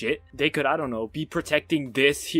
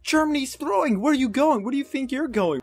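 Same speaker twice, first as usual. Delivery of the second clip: high, loud outraged shouting at the screen; outraged yell; 14 semitones higher, 6 dB louder than usual. Real, not acted. Yelling at AI Germany abandoning the front, furious outraged shout. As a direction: yell in disbelief at a useless ally.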